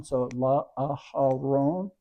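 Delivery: La Aharon said very slowly, syllable by syllable; sounding out La Aharon. Shown as a demonstration. Teacher sounding out La Aharon slowly. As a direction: stretch out La Aharon. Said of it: "Hey with a quarter note A".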